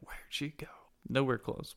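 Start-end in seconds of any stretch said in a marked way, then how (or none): 0.1-0.7 very soft, far-away mock yell; hushed imitation of yelling in the distance; distant sailor yelling. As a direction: imitate a faint shout from afar. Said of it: "you hear sailors yelling".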